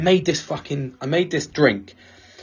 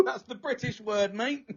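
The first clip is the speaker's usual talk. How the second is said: normal talking voice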